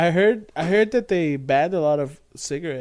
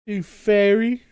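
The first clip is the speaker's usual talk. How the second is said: louder, higher jeering jab; mock insult; 6 semitones higher, 7 dB louder, slower than usual. Joking about old insults with friend, loud mock taunt. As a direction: fling it like a schoolyard taunt.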